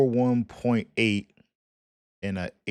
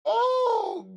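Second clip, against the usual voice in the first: high shout of shock; surprised shout. Real, not acted. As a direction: yelp in disbelief. Shocked reaction to the stats.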